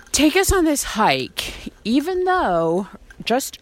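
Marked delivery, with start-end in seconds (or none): none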